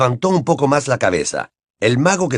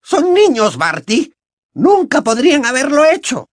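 very high-pitched, loud plea; pleading witch; 4 dB louder than usual. A narrator's reading. Witch defending the children to Crouch, pleading.

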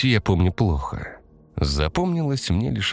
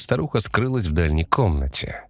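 plain reading voice

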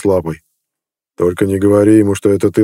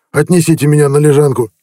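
pained order; 6 semitones higher than usual. A narrator's reading. Collapsing chief orders his men to carry him, shouting. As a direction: call out to be carried away.

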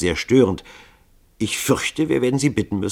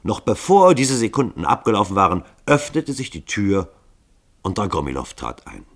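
ordinary delivery

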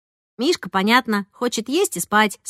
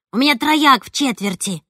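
ordinary delivery, nothing marked

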